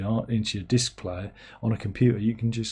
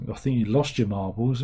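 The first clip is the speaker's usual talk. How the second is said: normal talking voice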